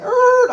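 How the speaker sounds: high squealing tire screech sound. Retold or imitated. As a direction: mimic the tires squealing round the corner. Screeching tires while weaving.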